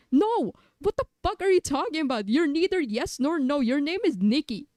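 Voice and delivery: high loud outraged retort; indignant yell. Acted. Other self yells at the streamer in outrage. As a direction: shout it down, offended.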